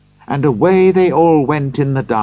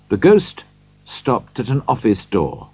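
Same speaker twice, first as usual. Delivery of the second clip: ordinary delivery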